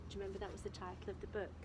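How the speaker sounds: plain reading voice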